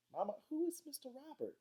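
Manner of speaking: put-on very high child's voice; questioning child. A narrator's reading. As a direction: ask it high like a puzzled kid. Child asks worried Mama.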